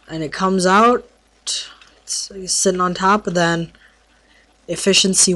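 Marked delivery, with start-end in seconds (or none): 1.4-2.3 slow hushed whisper. Real, not acted. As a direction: whisper it like a secret. Showing enchanted item.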